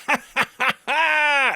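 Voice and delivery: big, high-pitched forced cackle; Tom Cruise laugh. Retold or imitated. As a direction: imitate an over-the-top movie star laugh. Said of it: "He's doing the Tom Cruise laugh".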